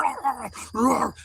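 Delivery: imitated K9 growling; police dog imitation. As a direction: growl and roar like an attacking dog. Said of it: "The canine's like".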